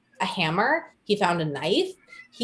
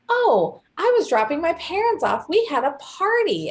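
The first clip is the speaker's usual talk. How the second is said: mock innocent excuse; 7 semitones higher than usual. Suspect explaining car sighting, higher mock-innocent breeziness. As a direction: toss off the excuse brightly.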